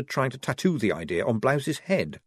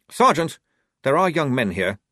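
sharp, louder rebuke with a high first word; snapped; 6 semitones higher, 6 dB louder than usual. A narrator's reading. Lieutenant rebuking the sergeant in front of the young recruits, sharp snap. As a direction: snap at the sergeant to drop the subject. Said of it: "snapped Blouse".

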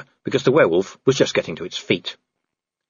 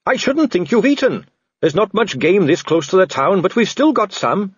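put-on high, eager young-officer style helpfulness; Carrot, cheerful; 7 semitones higher than usual. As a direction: chatter on cheerily, voice lifted. Voices Carrot, the cheerful rescuer.

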